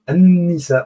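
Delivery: An-Nisa, doubled n, no l heard; solar letter. Shown as a demonstration. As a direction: say An-Nisa with the l assimilated. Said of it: "on n'a pas prononcé l'article Al".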